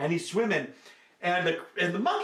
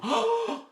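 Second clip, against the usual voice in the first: shocked gasp. Acted. Monkey shocked by threat.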